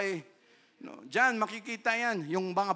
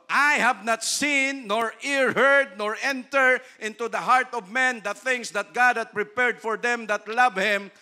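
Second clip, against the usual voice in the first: slow, much louder and higher; preacher declaiming the verse, slow and loud; preaching scripture; 5 semitones higher, 9 dB louder than usual. Acted. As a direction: thunder out the verse. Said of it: "sabi ng Word of God".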